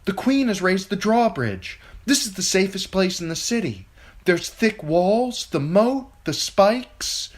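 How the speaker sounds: high, loud steady reassurance, slowing on thick walls; young lady reassuring. A narrator's reading. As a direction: talk the crowd down, loud and firm. Sansa calms the guests.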